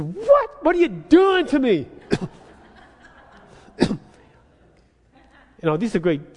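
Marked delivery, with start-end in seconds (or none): 0.0-1.9 high, loud exasperated yell; pleading outburst at a phone menu; frustrated shout. Retold or imitated. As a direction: yell it at the machine. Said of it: "I said".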